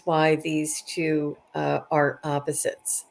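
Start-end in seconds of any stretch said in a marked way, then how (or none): none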